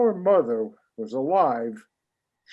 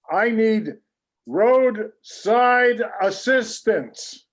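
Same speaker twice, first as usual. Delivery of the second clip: higher, drawn-out over-enunciated request; slow emphatic demand; 6 semitones higher, 3 dB louder, slower than usual. Acted. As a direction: spell it out slowly to a dumb machine. Shouting at phone menu.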